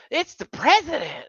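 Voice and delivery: high, thrilled shriek of news; excited squeal. Retold or imitated. Auntie gets a call, excited.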